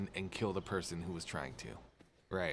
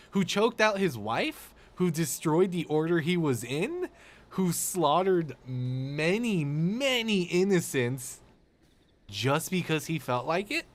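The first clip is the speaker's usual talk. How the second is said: incredulous rant; 6 semitones higher, 4 dB louder than usual. Real, not acted. Ranting about Vader's crimes.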